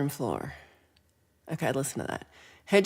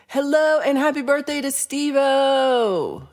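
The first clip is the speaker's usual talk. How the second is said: cheery greeting; 8 semitones higher, 7 dB louder than usual. Real, not acted. Cheery birthday greeting to viewer.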